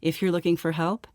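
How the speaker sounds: soft, even legal-notice read; disclaimer read. Acted. Host reads listeners the show's medical disclaimer, soft formal read. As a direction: read it out quietly and evenly, like small print.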